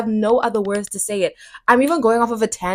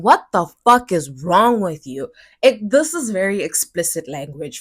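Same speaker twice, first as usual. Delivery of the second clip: ordinary talk, nothing marked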